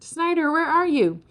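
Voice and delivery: high-pitched searching yell; screaming. A narrator's reading. Ferd looking for Snyder left behind, screaming. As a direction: call out urgently for someone lost. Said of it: "Ferd screamed".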